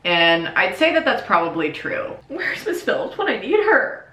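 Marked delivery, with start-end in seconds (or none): 2.3-4.0 mock pleading whine. Pleading to absent teacher.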